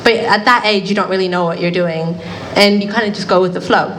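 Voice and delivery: normal talking voice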